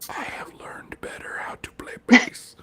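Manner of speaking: hushed, breath-only announcement; whispered volunteering. Retold or imitated. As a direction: whisper it as a sly offer to step in. Timidly volunteering to fill in.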